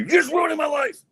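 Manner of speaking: very high, shouted mock outburst; mock parental yell. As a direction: blow up at the kid in jest. Mock parent yelling at child.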